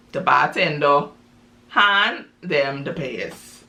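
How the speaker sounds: ordinary delivery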